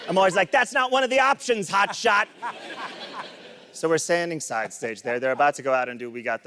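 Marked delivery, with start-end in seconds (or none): 0.5-2.3 high-pitched mock yell; Dennis Hopper. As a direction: taunt like a movie villain, pitched up. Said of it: "I yell at my kids sometimes like I'm Dennis Hopper".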